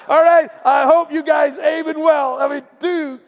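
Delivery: hammed-up high shout downrange; target holder calling out. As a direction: call out nervously to people aiming at you. Plays nervous target holder calling out.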